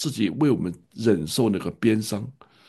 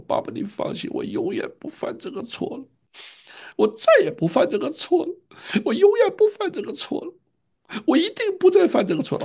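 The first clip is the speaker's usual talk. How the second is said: put-on wailing child. Son wailing to father, promising never again. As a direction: wail each promise higher like a boy in tears. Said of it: "他就在那嚎啕大哭".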